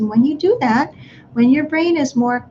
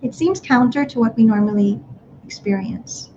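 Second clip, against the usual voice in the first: ordinary talk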